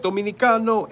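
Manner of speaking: high, punchy mocking Dominican accent. Camp leader mocking a New Yorker, fake Dominican accent. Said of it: "mock me".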